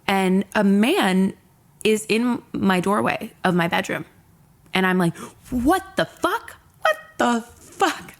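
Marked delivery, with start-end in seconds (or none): 5.5-8.1 high, slow, stunned retold shriek of disbelief; freaked-out disbelief. Finding a stranger in her bedroom doorway, high shocked disbelief. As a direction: stretch it out, pitched way up in shock. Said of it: "Like, I freak and I say this".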